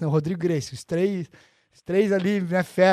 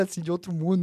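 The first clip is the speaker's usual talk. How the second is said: ordinary delivery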